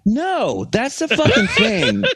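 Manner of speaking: high, emphatic correction with a laugh; emphatic protest. Real, not acted. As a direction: burst out with the answer. Exasperated answer to listener's question.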